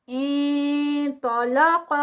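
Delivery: انطلق drawn out with a full, heavy nasal before ط; slow heavy recitation. Shown as a demonstration. Teacher shows the heavy ikhfa slowly. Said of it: "اب اخفا".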